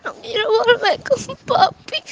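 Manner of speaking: high, slow, frightened plea; scared, crying voice; tearful pleading. Real, not acted. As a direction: plead through tears, drawing the words out. Frightened child pleading with mother.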